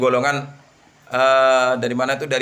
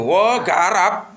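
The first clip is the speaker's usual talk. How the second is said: mock eager reaction; 4 semitones higher, 3 dB louder, much slower than usual. Mock reaction of an ordinary man.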